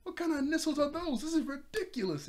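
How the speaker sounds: very high-pitched indignant protest; hammed-up high, angry disbelief; mock outrage. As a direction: act out being really mad, voice shooting up. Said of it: "like, really mad".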